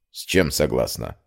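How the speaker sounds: low, soft male-character question; surprised rector. A narrator's reading. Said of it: "Амир удивлённо взглянул на меня".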